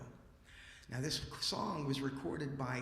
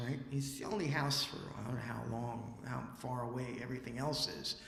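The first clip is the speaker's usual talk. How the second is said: normal talking voice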